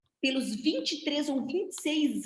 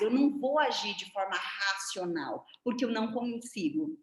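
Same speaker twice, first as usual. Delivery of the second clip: ordinary talk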